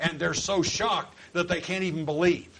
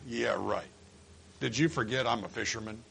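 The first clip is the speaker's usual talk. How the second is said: low, dry disbelief; deadpan incredulous objection; sceptical; 6 semitones lower, 4 dB quieter than usual. Acted. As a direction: answer back doubtfully, quiet and low. Acting sceptical apostles answering Jesus.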